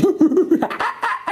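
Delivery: quick high monkey hoots and screeches; monkey chatter. Acted. Monkey told to come down, high chattering. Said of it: "and the monkey".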